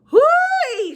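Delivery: put-on high, far-off old woman voice; Gloria Dump voice. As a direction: pitch her up as an old lady. She said.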